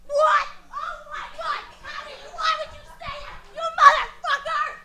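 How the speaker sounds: high, shrill-free fake fury, acted offense; mock outrage. Comic fake outrage at a volunteer's answer, high and indignant. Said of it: "I'm kidding. I was acting".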